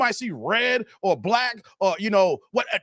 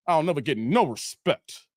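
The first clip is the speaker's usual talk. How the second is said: Rodney Dangerfield line; 5 semitones lower than usual. Retold or imitated. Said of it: "Rodney Dangerfield".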